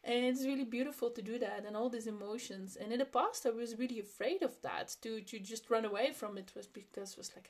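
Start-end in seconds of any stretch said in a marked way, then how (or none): none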